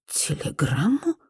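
slow, quiet surprised question; an old crone, taken aback. A narrator's reading. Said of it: "удивилась старая корга". (удивлённо и медленно переспрашивая)

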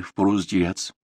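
plain reading voice

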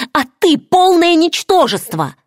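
high-pitched angry insult; furious. A narrator's reading. Struck woman insulting her attacker, furious. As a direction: hurl the insult with rising fury. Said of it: "в ярости сказала я".

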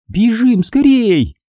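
high, stretched alarm; frightened urging. Acted. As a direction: urge them high and scared. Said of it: "испуганно проговорила Минди". (испуганно, высоким голосом)

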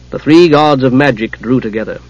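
ordinary delivery, nothing marked

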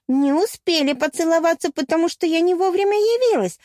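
high, rising jealous accusation; jealous retort. A narrator's reading. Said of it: "возразила ревнивица". (ревнивое возмущение)

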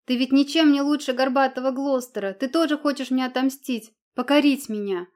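cornered woman lashing back; Anna hurls defiance at the prince, loudly; defiant shouting. A narrator's reading. Anna defies them with a firebrand.

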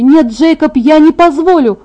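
loud, high, desperate protest. A narrator's reading. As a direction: refuse at full cry. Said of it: "Мой голос стал громче". (громко, высоко, с отчаянным протестом)

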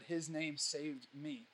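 slow and soft, almost under the breath; hushed sincere testimony. Real, not acted. Speaking to the crowd about his rescue, slow and hushed. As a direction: let the words sink, quiet and slow. Quiet testimony of being saved.

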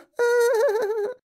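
put-on high shrieking girl laugh; bratty cackle. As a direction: cackle gleefully at the prank.

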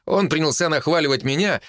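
ordinary delivery, nothing marked

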